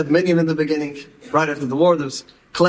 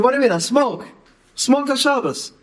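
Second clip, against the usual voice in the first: put-on defiant teen; 8 semitones higher than usual. Defiant boy answers the Rebbe.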